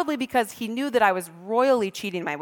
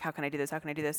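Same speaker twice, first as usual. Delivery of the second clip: soft, low fretting; worried inner voice; 6 semitones lower, 13 dB quieter, much faster than usual. Retold or imitated. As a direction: mutter to yourself in a panic. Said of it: "I remember thinking".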